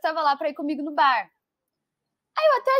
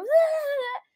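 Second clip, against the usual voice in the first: tearful wail; 11 semitones higher than usual. Retold or imitated. Crying sound in retold scene.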